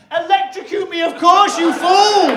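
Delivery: loud, high screaming at husband; furious shout. Retold or imitated. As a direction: yell at husband in labour pain. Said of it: "I'm in so much pain".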